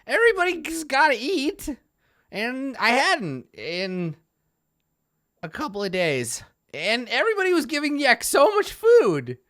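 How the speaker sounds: high, whining goblin voice. Acted. Hungry goblin complains about being denied food, high whine.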